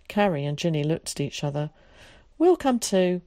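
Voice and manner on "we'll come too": higher, louder start to a resigned agreement; reluctantly going along; resigned sigh. A narrator's reading. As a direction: give in with a mild sigh. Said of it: "they sighed in a tone of resignation".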